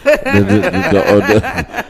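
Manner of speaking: stammering through real laughter; can't get words out for laughing. Real, not acted. Laughing before telling grandmother story.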